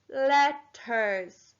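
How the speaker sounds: slow, drawn-out LETTERS; syllable counting. Shown as a demonstration. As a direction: stretch both syllables of LETTERS. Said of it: "Let's count out the syl".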